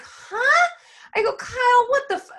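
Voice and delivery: high, loud mock outrage at husband; retold disbelief. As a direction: squeak out a stunned what the. Retelling shocked reaction to husband.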